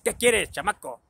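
a hint of a hollering auntie; aunt calling back. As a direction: imitate an aunt answering a yell. Aunt answers the shouted call.

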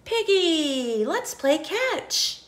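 high, slow elephant voice; Gerald voice. Acted. Elephant asks Piggy to play, high and slow. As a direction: call to Piggy brightly and slowly.

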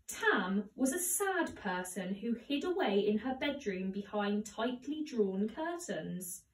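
ordinary delivery, nothing marked

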